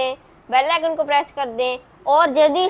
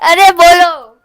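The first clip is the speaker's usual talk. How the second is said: loud urging; 5 semitones higher, 12 dB louder, slower than usual. Real, not acted. Urging the pet to answer.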